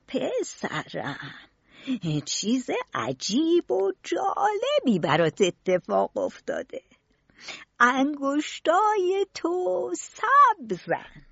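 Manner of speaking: very slow, drawn-out revelation; old gardener, solemn wonder. Acted. Gardener revealing a wonder to his pupil, solemn.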